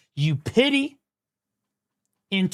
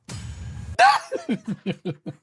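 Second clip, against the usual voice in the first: gleeful laugh. Real, not acted. Laughs at rival's failure.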